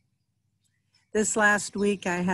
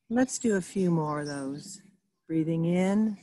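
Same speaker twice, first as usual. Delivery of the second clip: guided meditation. Real, not acted. Reverend guiding listeners into stillness through breathing, calm guided meditation. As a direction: lead them gently through each breath, leaving space.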